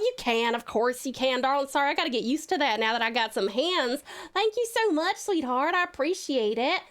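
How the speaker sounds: chatty Southern hostess with stretched vowels; Southern accent. Pretend. Streamer granting heart hands to viewers, Southern accent. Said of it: "now it's time for the accent redeem".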